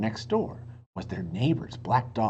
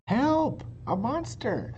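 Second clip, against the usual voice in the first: Annie, frightened; 11 semitones higher, slower than usual. A narrator's reading. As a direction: cry out like a scared little girl. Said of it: "said Annie".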